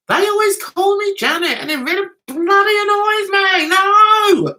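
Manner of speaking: high, rising exasperation ending in a loud No; worked-up, high-pitched complaint; exasperated rant. Real, not acted. Ranting about being called Janet.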